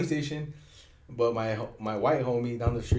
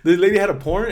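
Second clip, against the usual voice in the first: higher, louder outraged; scandalized; 7 semitones higher, 8 dB louder than usual. Real, not acted. Co-host telling about grandma's porn, scandalized. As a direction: hit the shock of it. Shocked retelling.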